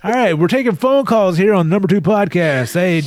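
over-the-top DJ taking calls, laughing; talk show host. Pretend. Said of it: "It's your talk host voice".

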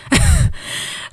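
loud, high breathy laugh. Real, not acted. Storyteller cracking up at her own comeback, loud laugh. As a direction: burst out laughing at your own joke.

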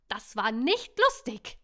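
loud, slow scolding; loud slow angry scold; shrieking angrily. A narrator's reading. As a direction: scold him hard, word by word. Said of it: "keifte sie böse".

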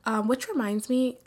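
normal talking voice

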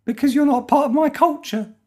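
a creepy cult believer with his own calendar; cult member. Pretend. Host playing a cult member to Rob, high eerie voice.

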